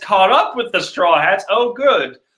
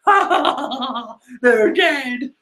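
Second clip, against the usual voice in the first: gloating laughter; 8 semitones higher than usual. Retold or imitated. Villains cackling at ship.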